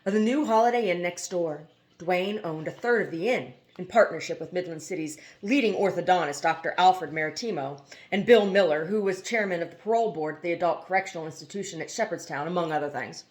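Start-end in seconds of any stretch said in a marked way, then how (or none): none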